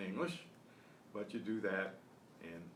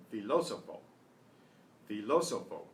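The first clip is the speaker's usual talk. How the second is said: "filósofo" punched on -ló-, the last two syllables light; antepenult stress; 4 dB louder than usual. Shown as a demonstration. Teacher explaining the written accent mark, "filósofo" stressed on -ló-. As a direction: hit the second syllable of "filósofo". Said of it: "next to the last syllable".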